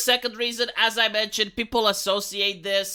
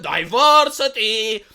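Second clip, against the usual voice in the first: slow, louder mock announcement; sarcastic slogan, drawn out; 5 dB louder, slower than usual. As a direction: boom it out like a stadium slogan. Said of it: "You go to a baseball stadium".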